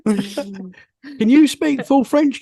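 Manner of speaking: usual speaking voice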